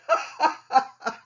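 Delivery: loud, high gleeful cackle; villain laugh. Acted. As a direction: laugh off down the beach like a rogue. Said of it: "he laughed as he drove".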